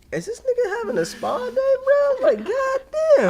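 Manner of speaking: high-pitched laughing disbelief at the scene; retelling finding a friend's dad at a foot spa, laughing shock. Retold or imitated. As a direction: squeak out your amazement through a laugh.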